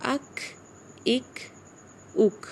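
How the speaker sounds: "اک" ending in a k with breath flowing out; short "اک", aspirated k with no echo; breathy kaf release. Shown as a demonstration. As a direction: let air carry on after the k of "اک". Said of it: "کاف کی آواز نکالتے ہیں تو سانس جاری رہتا".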